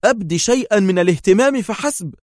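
high-pitched irritated demand; heated, exasperated reply; sharp. A narrator's reading. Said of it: "أجاب يائيل في حدة".

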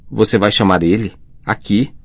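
plain reading voice

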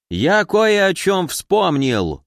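high, drawn-out exclamation of recall; sudden exclamation. A narrator's reading. Gavriil suddenly remembering something about old acquaintances, exclaiming. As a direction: exclaim as it suddenly comes back to you. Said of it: "вдруг воскликнул Гавриил".

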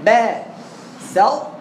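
بسط drawn out very slowly toward the ط; slow sound demo. Shown as a demonstration. Teacher shows where the tongue goes, very slow. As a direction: stretch بسط so the ط can be heard. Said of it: "رايح على الطاء".

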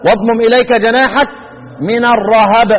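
الرهب recited as ar-rahab; verse ending in two-syllable "ra-hab" for الرهب; first of three readings. Shown as a demonstration. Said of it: "ثلاث قراءات".

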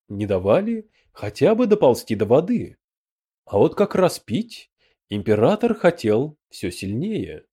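plain reading voice

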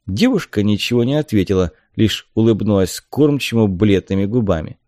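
usual speaking voice

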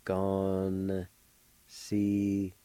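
Gone held long, then Sinuste in slow separate syllables. Shown as a demonstration. Teacher joining N to G A, slow syllable by syllable. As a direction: draw out Gone, pause, break Sinuste into beats. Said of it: "pronounce the N sound with the G A like this".

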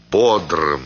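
usual speaking voice

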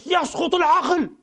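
high, drawn-out shouted slogan; mock general's shout. A preacher imitating the general shouting a slogan. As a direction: bellow it like a raging general. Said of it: "قام يصرخ بعرجته يقول".

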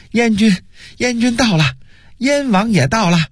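high-pitched breathless announcement of news; excited shouting. A narrator's reading. Messenger announcing the army's arrival, excited shouting. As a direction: burst in crying out the great news. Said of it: "兴奋地喊道".

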